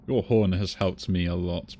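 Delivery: ordinary delivery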